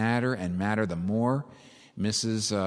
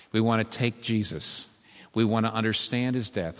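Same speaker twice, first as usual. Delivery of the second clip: ordinary delivery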